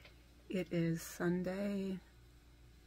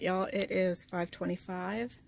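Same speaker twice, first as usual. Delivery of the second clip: normal talking voice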